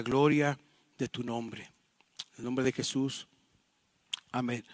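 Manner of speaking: low, soft prayer in short phrases; quiet closing prayer. Real, not acted. Pastor thanking the Lord to end the sermon, quiet prayer. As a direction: pray gently, pausing between petitions.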